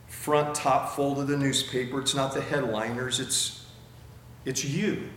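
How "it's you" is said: ordinary talk, nothing marked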